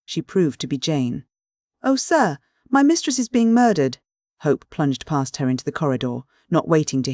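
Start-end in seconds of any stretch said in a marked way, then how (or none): 1.8-4.0 high, loud, panicked plea; a frightened servant begging for help, panicked; terrified maid. A narrator's reading. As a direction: gasp it out in terror.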